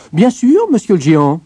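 high, loud, eager reply; obliging little tailor. A narrator's reading. Tailor agrees to help the giant, obligingly. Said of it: "répondit le petit tailleur".

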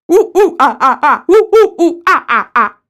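high, loud hooting monkey calls; chimpanzee imitation. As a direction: hoot like a chimp, half laughing. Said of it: "act like a chimpanzee".

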